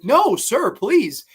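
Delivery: high, loud mock plea; pleading teacher. Teacher pleading with the angry father, high and loud. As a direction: beg him to stop, voice up high. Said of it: "the teacher's like".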